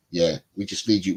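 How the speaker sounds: ordinary talk, nothing marked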